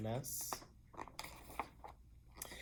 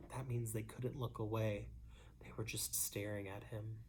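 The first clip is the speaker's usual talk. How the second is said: whisper; 4 semitones lower, 12 dB quieter, faster than usual. Real, not acted. Aside to children.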